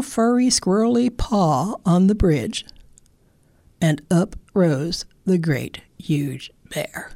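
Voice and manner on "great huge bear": soft whisper. A narrator's reading. Narrator revealing the bear, whispered. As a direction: hush the reveal. Storyteller builds suspense.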